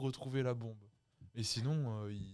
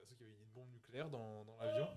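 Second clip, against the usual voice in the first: far from mic; 6 dB quieter than usual. Real, not acted. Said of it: "Micro, approche-toi du micro".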